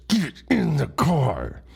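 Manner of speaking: slow, tight order through clenched teeth; clenched-teeth mad mom. Retold or imitated. Mom ordering her son into the car, clenched-teeth anger. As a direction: imitate an angry mother talking through her teeth. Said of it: "clenching her teeth whenever she was mad".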